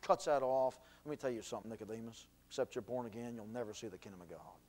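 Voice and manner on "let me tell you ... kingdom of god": quiet. Acted. Jesus answers Nicodemus quietly.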